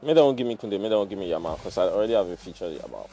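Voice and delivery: ordinary talk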